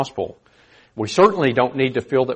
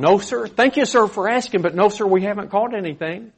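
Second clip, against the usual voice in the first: a mildly higher, courteous no sir; polite no; 7 semitones higher than usual. Pretend. Fishermen politely answer the stranger, mildly courteous. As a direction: decline with mild courtesy. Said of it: "There's the polite form".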